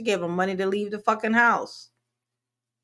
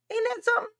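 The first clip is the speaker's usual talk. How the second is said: mocking high voice; 13 semitones higher, 3 dB quieter, much faster than usual.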